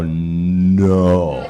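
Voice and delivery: retold, drawn-out refusal; long drawn-out no. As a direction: stretch the vowel out into a slow refusal.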